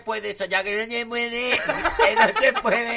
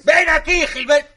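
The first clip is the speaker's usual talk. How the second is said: loud, higher bratty royal demand; spoiled queen shouting; 5 semitones higher, 5 dB louder, slower than usual. Pretend. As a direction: bellow for your butler like a spoiled queen. Reina exige al mayordomo. (reina mimada gritando al mayordomo Gilberto)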